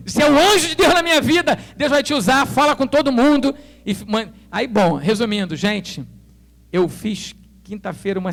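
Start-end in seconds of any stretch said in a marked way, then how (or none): 0.1-3.5 higher, louder, fervent thanks; grateful praise. Retold or imitated. To the notary friend helping him, fervent thanks. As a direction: lift him up with fervor.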